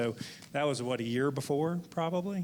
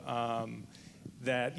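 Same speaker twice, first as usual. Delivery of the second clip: ordinary talk, nothing marked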